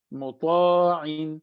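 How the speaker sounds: مطاعن said slowly with a heavy, full ṭā; slow heavy demo word. Shown as a demonstration. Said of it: "بھاری کر کے پڑھنا ہے".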